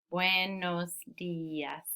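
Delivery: "Buenos dias" very slowly, split as bue-nos di-as; syllable by syllable. Shown as a demonstration. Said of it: "Four syllables".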